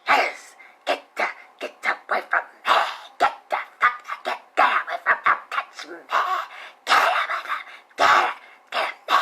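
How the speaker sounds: hissing snake voice. Retold or imitated. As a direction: hiss the words like a serpent. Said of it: "sound like a snake to me".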